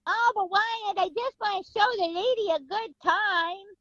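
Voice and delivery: put-on high voice, pleading to be let off; Ike. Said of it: "the other voice, Ike Clan, goes".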